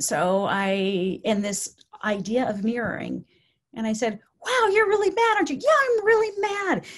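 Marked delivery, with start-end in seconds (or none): 4.4-5.6 high, loud matching of agitation; match an upset person's pitch and force; mirrored anger. Retold or imitated. Caregiver mirroring an agitated resident, heated and emphatic. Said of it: "I mirrored her anxiety and anger". 5.6-6.8 high, loud, indignant retort; angry agreement. Retold or imitated. Agitated resident answering the caregiver, angry. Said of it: "her anxiety and anger".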